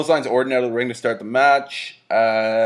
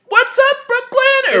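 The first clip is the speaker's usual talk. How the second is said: much higher, slow, airy put-on girly voice; mock Maria greeting; 6 dB louder, slower than usual. Host mimics Maria greeting the crowd in a high breathy coo. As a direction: coo it like a ditzy announcer. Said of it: "she's like".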